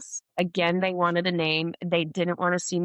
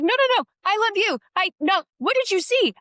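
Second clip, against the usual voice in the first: very high, louder flustered protest; flustered confusion; 14 semitones higher, 6 dB louder than usual. Retold or imitated. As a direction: gush it out high, thrown and embarrassed. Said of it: "I was so confused".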